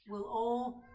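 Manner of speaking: usual speaking voice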